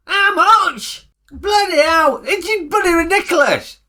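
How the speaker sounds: high, incredulous questions rising to outrage; can't believe the diesel price; indignant disbelief. Acted.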